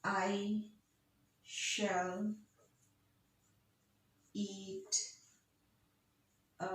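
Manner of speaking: each word spaced out slowly with long pauses. Shown as a demonstration. Teacher setting out a sentence word by word for students to count. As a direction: lay out every word on its own beat. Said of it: "Let's count the beats".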